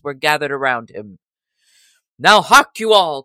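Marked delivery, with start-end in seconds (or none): 2.2-3.2 loud, higher call for attention; rallying cry. A narrator's reading. Robin calling his gathered yeomen, loud rallying cry. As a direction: call the whole band to listen. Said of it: "cried Robin".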